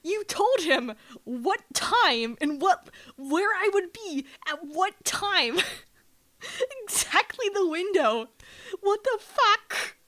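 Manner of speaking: high, loud, drawn-out scolding; incredulous yelling at a friend; exasperated yelling. Retold or imitated. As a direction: retell the telling-off, stretching each accusation. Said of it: "I had to yell at her".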